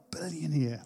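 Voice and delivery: "Billionaire" said low and very soft, falling in awe; awed hush. Retold or imitated. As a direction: breathe "Billionaire" out softly like it stuns you. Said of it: "I was like".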